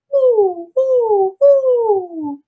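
high, loud vocal warm-up woos. Shown as a demonstration. Teacher leading a voice warm-up with high woos. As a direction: slide up into bright high woos. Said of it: "Can you go".

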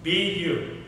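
ordinary talk

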